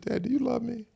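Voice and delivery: put-on high childlike question; small daughter's voice. Daughter asking her dad from the back seat, high and childlike. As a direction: ask it like a little girl in the car. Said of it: "she said".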